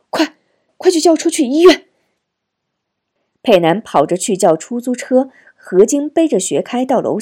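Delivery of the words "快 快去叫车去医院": fast, high, loud order; urgent command. A narrator's reading. Wife holding sick son orders husband, loud urgent rush. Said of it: "命令丈夫".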